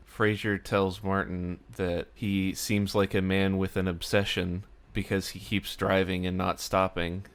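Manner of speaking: ordinary talk, nothing marked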